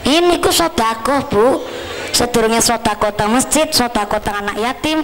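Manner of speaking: ordinary talk, nothing marked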